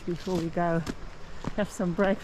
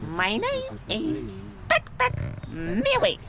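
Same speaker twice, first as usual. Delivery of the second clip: higher, slow squeaky cartoon voice; squeaky character voice; slower than usual. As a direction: stretch it out in a tiny squeaky voice. Child introduces herself in silly voice.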